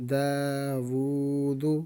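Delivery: داوودو read very slowly with every vowel voiced: daa-woo-doo; drawn-out teaching read; slow joined reading. Shown as a demonstration. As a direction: patiently join the syllables of داوودو. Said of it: "دال پیش دو".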